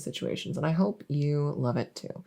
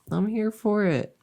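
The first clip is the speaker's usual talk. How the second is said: ordinary delivery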